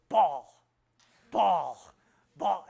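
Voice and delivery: loud, blunt repeated word; toddler boy voice. Retold or imitated. Grandson Dawson saying his one word, loud and blunt. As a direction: bark it like a two-year-old.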